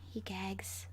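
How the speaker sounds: plain reading voice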